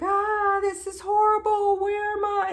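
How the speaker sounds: high, loud wail of horror; retold terror, voice shooting up; panicked. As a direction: act out frantic disorientation. Acted panic, against calming voice.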